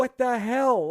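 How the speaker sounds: loud, high, disbelieving "what the hell"; exasperated outburst. Retold or imitated. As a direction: blurt it out in total disbelief. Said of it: "I'm just like".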